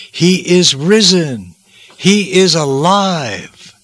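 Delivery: high ringing words set apart by pauses; triumphant proclamation. Acted. The angel announces the resurrection to the women, triumphant proclamation. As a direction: proclaim it slowly, word by word, building in triumph.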